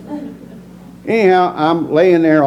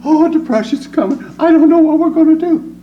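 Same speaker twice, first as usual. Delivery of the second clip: much higher, mock fretting voice; hammed-up frightened neighbour; panicky worrier; 14 semitones higher, 5 dB louder than usual. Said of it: "somebody comes".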